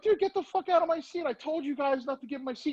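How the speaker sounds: very high hammed-up mock demand; mock outrage. Retold second mock-angry demand.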